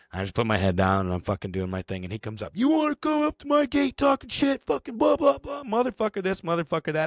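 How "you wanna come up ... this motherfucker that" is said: very high-pitched angry tirade; mock yelling. Gate guy confronting the driver, high mock yelling tirade. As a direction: imitate a ranting man, pitched way up. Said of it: "You started yelling at me".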